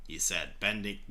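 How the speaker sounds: ordinary delivery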